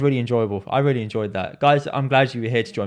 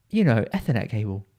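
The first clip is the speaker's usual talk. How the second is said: ordinary talk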